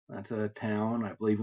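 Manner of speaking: ordinary delivery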